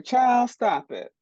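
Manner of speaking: high, slow mock scold; sassy scolding. Telling young queer artists what she'd say, sassy scold. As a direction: shoo the worry away like an auntie. Advice quote to young self.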